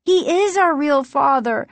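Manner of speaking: high, urgent insistence; insistent. A narrator's reading. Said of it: "Margaret insisted".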